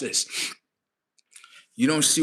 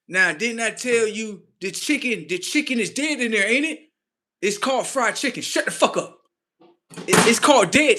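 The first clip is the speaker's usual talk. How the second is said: pitched-up squabbling; insistent mock voice; 8 semitones higher than usual. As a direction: argue the point in a raised comic voice.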